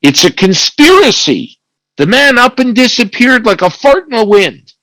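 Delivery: high-pitched, quick outburst, not really shouted; mild mock yell. Angry warden at the prison guards after an escape, mock yell. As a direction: play at yelling, pitch up but volume moderate. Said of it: "he yells at them and said".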